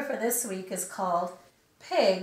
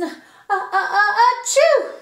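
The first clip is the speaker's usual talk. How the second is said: gasps climbing higher and louder into a sneeze; sneeze build-up; 11 semitones higher, 13 dB louder than usual. Acted. Gerald sneezing again while apologising, climbing sneeze. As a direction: build it up and let it explode.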